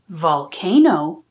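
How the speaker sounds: ordinary delivery, nothing marked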